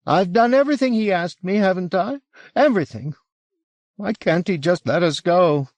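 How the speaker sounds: high-pitched, overwrought complaint; desperate husband railing at cruel treatment; anguished pleading. A narrator's reading. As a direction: plead high, as if bitterly wronged. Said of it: "His pleas hardened into assertions".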